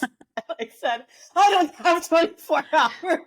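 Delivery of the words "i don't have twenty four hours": higher, louder mock exasperation with a laugh; exasperated plea. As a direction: throw up your hands at the bakery. Pleading with the Publix baker.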